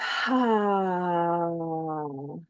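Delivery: long soft audible sigh out through the mouth; voiced exhale sigh. Shown as a demonstration. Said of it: "auditory noise that you make when you exhale".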